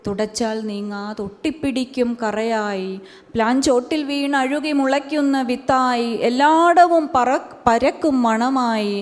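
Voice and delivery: rhythmic recitation swelling in the middle; poetry recital. Acted. Poet reciting her poem on women, rising recital. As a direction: let the list build, then release.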